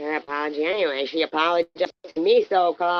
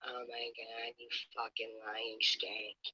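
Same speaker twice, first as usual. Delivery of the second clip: low, much softer disgusted mutter; real disgusted mutter, far softer; muttered under breath; 7 semitones lower, 19 dB quieter than usual. Real, not acted. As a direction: spit it out under your breath. Muttered insult during argument.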